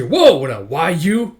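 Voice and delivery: loud, drawn-out, high "whoa"; startled outcry. Acted. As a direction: yell as if caught off guard. Startled enemy under fire.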